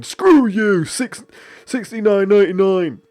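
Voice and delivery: high, loud, mock-furious yell; angry Homer. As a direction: bellow the price back like an irate cartoon dad. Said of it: "Homer comes and is like".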